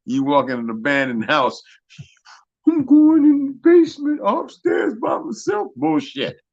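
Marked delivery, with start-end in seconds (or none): 2.7-5.7 much higher, slow, silly naive voice; mock horror movie victim. Mocking a horror movie victim who heads into the basement, hammed-up and slow. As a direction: play the clueless horror film character going in alone. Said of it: "don't be like goddamn Scooby movies".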